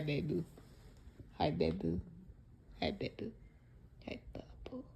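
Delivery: hushed, cooing pet talk; talking to a cat. Real, not acted. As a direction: coo softly at a beloved cat.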